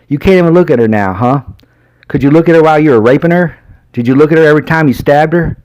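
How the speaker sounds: a hint of an enraged sheriff grilling the suspect; accusing sheriff. A narrator's reading. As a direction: hammer him with the questions. Sheriff interrogating hard.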